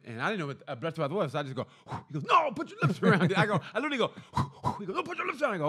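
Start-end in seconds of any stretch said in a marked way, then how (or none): 2.2-3.4 imitating the cop, higher and louder; mock cop bark. Cop to drunk driver, mock bossy bark. Cop quote, loud and high. 5.0-5.6 imitating the cop, much higher; mock cop. Cop repeating his order, mock impatient higher voice.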